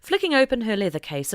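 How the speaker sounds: plain reading voice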